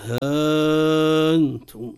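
ها أنتم with haa held very long; long madd. Shown as a demonstration. Student trying out Warsh's lengthening, ها أنتم with a long held vowel. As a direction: stretch the vowel of ها on a steady note before أنتم.

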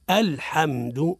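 الحمد slowly, opening on a crisp light "a"; clear hamza. Shown as a demonstration. Teacher shows the start after a pause, slow precise hamza. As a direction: articulate the initial hamza in الحمد. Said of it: "بتحقيق الهمزة وترقيقها".

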